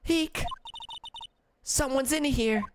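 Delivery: high, sudden startled squeak; high-pitched startled girl voice; startled squeal. Acted. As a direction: jump at finding someone there. Startled girl finds someone inside.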